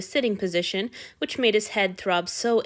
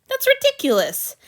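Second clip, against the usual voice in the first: much higher, loud outburst; outraged; 6 dB louder than usual. A narrator's reading. God outraged at the servant's closet, loud outburst. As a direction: explode in indignation.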